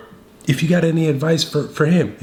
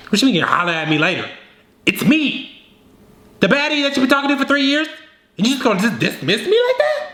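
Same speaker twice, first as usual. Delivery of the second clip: higher, quick offended protest; hammed-up affronted ex who expected better; mock indignant woman; 6 semitones higher, faster than usual. Ex to the man who brushed her off, mock indignant.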